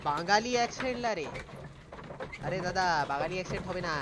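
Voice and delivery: loud, rapid-fire Bengali outburst; loud Bengali exclamation. Pretend. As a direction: call it out fast and loud in Bengali. Playful outburst about a Bengali accent.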